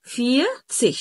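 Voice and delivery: "فيرتسيك" in two parts, R dropped; correct silent R. Shown as a demonstration. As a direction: say "فيرتسيك" as fia, tsich. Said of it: "تشيله كأنه مش موجود".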